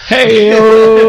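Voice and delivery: loud, high, laughing hey-o call; excited hey-o. Real, not acted. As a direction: cheer it out like a talk show sidekick. Joking exclamation after punchline.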